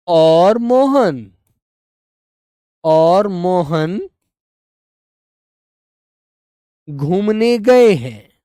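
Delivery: very slow, word by word; slow dictation. Shown as a demonstration. Teacher dictating sentence to students, very slow. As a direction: drag it out so they can write. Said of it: "आप कैसे लिखेंगे?".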